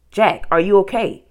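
calling out. A narrator's reading. Girl checks on her brother from the treehouse, calling out. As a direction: call out to check on someone. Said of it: "Annie called".

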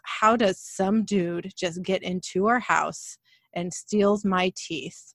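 put-on slow, grave little boy voice; slow it right down, dead serious like a worried kid. Son asking mom gravely who enters the house, slow and serious. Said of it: "he got all serious and he goes".

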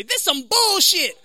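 high, slow exaggerated complaint. Shown as a demonstration. Showing the crowd his catchphrase, high drawn-out caricature.

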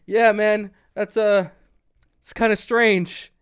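high, loud quote of someone dodging the question; acted casual brush-off. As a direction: play someone loudly shrugging it off. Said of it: "he's like".

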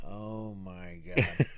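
low, slow, drawn-out dread; mock dismay. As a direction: imitate an appalled dad, dropped low and soft. Dismayed dad reacts in retelling.

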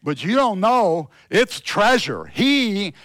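loud, high, fervent preaching. Acted. Pastor preaching to the church, fervent and loud. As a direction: preach it with full force to the congregation.

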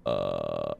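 deep creaky fry rattle; low croaking fry sound; low vocal fry croak. Shown as a demonstration. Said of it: "we can take that fry tone".

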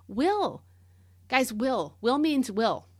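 emphatic, insistent "will"; extra oomph on will. Shown as a demonstration. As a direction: punch each "will" as a firm, final word. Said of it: "a little extra oomph in the word will".

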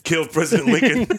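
high, loud laughing line. Real, not acted. Co-host retelling the movie plot, laughing hard. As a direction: crack up mid-sentence.

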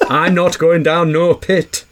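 laughing mock Yorkshire boy; broad Yorkshire, slow and giggling; Yorkshire accent. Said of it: "Imagine someone doing an Audible".

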